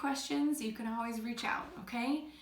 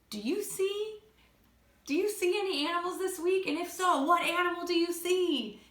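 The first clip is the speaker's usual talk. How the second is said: high, lively questioning; playful excitement; 7 semitones higher, 4 dB louder than usual. Real, not acted. Teacher asks pupils to look for animals, playfully excited. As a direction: build eager suspense for a search game.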